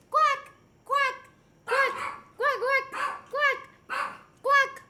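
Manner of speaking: high quacking like a duck; playful high duck quacks; duck quack imitation.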